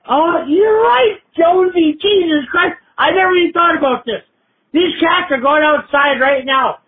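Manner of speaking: high, worked-up cry of sudden revelation; outraged realization. Acted. Caller tells Jonesy his cats must go, outraged realization. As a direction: exclaim it high, drawing out the first words.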